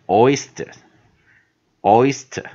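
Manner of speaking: OYSTER said slowly, loud on the first syllable; pronunciation demo. Shown as a demonstration. Teacher reading the new word, slow pronunciation demo. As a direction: model OYSTER with a long OY and a light ending. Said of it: "所以我直接念".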